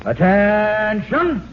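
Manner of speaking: long drawn-out, high military "attention" call; drill captain's command. Pretend. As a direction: call troops to attention, stretching the word. Playing captain, calls troops to attention.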